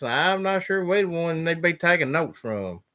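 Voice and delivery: ordinary talk, nothing marked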